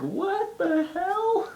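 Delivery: very high, drawn-out slow what the hell; shocked reaction. Retold or imitated. A friend shocked by the cartoon video, high and slow. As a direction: stretch it out in disbelief. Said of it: "I showed Nicole and she was like".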